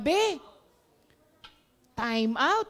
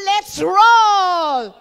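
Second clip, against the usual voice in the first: slow, loud, stretched battle cry; drawn-out rallying shout; 4 semitones higher, 11 dB louder than usual. Acted. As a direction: rouse the whole room, stretching roll. Climactic rallying cry to congregation.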